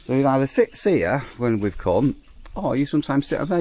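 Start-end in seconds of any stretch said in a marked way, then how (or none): none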